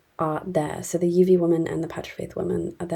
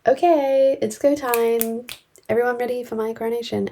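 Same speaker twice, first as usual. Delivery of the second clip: higher-pitched mock announcement; acted eager usurper; 5 semitones higher, 3 dB louder than usual. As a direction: play a usurper rallying for his own coronation. Acts out usurper announcing his coronation.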